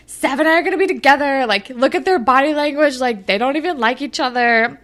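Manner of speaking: put-on overconfident teen. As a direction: imitate a cocky girl rattling on. Voicing a confident Jenna.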